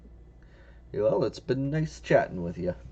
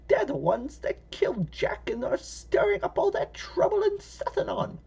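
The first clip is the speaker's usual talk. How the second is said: put-on high hushed old woman whisper; whispering old lady. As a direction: whisper it like a fearful granny. Old lady whispers fearful gossip.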